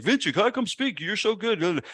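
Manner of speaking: high, sing-song mock flattery; mocking the boss who never asked him to speak; mock pleading. Mock of Chris begging him.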